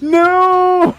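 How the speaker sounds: high, loud mock wail of dismay; mock anguished cry. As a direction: wail it out as playful protest. Laughing dismay at chat suggestion.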